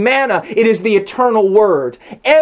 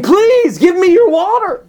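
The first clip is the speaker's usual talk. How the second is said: high, eager plea on "your water"; pleading woman; 11 semitones higher, 3 dB louder than usual. Retold or imitated. Preacher voicing the woman now begging Jesus, high and eager. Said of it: "to saying".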